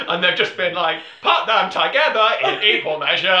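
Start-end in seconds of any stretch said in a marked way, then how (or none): 1.2-3.3 louder, higher, laughing through the order; laughing mock order. Podcaster mocks Netflix executives, laughing. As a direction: dictate the recipe while giggling.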